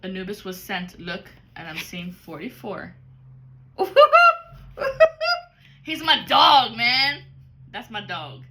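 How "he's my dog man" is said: real laugh. Real, not acted. Laughing about dog card.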